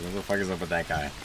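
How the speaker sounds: soft, low muttered aside; guard grumbling quietly to his buddy; guard muttering. Acted. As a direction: mutter it to a friend beside you. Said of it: "you kinda hear him mutter".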